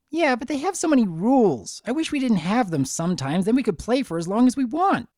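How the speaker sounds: high, fast colt voice; eager colt. Acted. Colt telling the twins about rules, high and quick. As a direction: rattle it off like a carefree kid.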